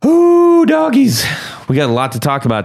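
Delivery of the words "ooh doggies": high, loud yelp of glee; excited whoop. Real, not acted. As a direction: whoop it out like a cowboy. Excited exclamation.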